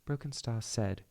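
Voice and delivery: put-on amateur British accent. Clan leader answering an accusation, British accent. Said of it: "I should start doing her British accent now".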